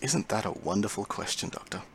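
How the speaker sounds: soft, sinister villain voice; quiet menacing villain. Acted. Villain purring reply.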